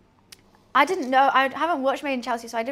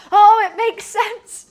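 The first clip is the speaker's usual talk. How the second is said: much higher, much louder and slow, a hammed-up neighbour; mock neighbour; 8 semitones higher, 10 dB louder, slower than usual. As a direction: play the lady's sudden enlightenment as a big mock voice. Mimicking the neighbour's reply.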